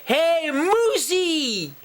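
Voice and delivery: high, drawn-out calling out; storybook yell. A narrator's reading. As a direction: call the moose slowly in a high voice. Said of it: "and yelled".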